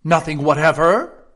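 "Nothing whatever?" loud, rising at the end; insistent question. A narrator's reading. King pressing Alice, "Nothing whatever?" loud and questioning. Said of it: "persisted the king".

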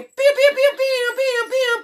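very high, squeaky laser pew sounds. Acted. Vlogger's intro, high squeaky laser sound effects. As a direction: fire cartoon lasers with your voice.

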